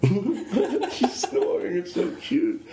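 slow, high laughing coo. Real, not acted. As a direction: melt over the snoring dog. Cooing at snoring dog.

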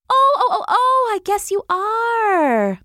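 high, loud string of ohs; flustered hummingbird. A narrator's reading. Hummingbird conceding to the wasps, high flustered voice. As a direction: squeal it, then stretch out the last word. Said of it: "still talking at a rapid pace".

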